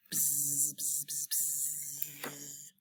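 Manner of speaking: hushed bee buzz; soft buzzing. Acted. Showing kids the bee's buzz, soft buzzing.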